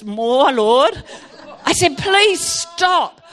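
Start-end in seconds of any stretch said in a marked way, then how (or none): 0.0-1.0 low, insistent mock prayer; lowered voice, rising at the end; imitated praying minister. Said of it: "And he goes". 2.0-3.2 mild mock scream, higher pitched; raised-pitch retold begging. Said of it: "I was screaming, absolutely screaming".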